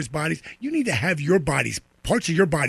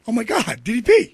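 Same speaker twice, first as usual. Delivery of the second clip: higher, louder mock gasp of delight; imitating starstruck fan; 9 semitones higher, 7 dB louder than usual. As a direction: ham up the fan's double take. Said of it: "He looked back and went".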